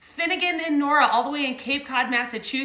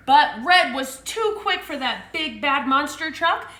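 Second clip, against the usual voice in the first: plain reading voice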